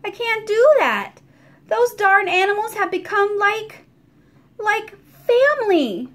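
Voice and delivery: landlady admitting the animals are family; softened landlady. A narrator's reading. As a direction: let the love for the pets show. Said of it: "the lines in her face soften".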